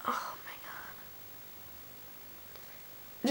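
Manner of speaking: a real exasperated whisper; whispered oh my God. Real, not acted. Remembering the anger, exhales.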